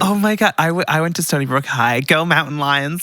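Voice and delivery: American school pride, lifted; American accent. Acted. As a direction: gush in a put-on American accent. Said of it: "I think we should do American".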